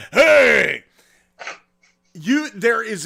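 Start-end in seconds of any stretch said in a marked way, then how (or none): 0.1-0.8 cackling, bellowed talking-seal impression; laugh, then holler like a drunk Maine pirate; loud, high mock shout; Hoover. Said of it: "And then Hoover looks at him and goes".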